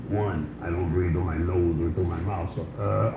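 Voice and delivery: low, soft rasp of a trach speaker. Retold or imitated. Comedian imitates the date explaining her trach, low and soft. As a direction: speak like air through a throat tube.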